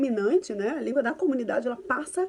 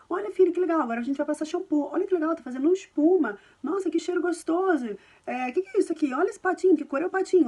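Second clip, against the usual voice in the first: put-on narrating bath to a baby; 5 semitones higher than usual. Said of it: "você narra o banho".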